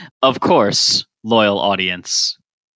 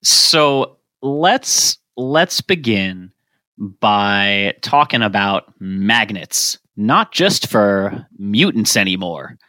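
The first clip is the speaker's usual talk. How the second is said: dramatic slow delivery; 3 semitones lower, much slower than usual. Acted. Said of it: "dramatic segment opener".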